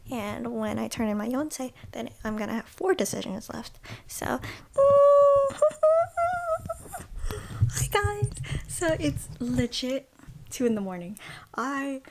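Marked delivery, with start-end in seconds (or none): 4.5-7.7 high, loud laugh breaking into sobs; overjoyed laugh-sob; laughing cry. Real, not acted. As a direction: let out a teary laugh of joy. Joy after acceptances.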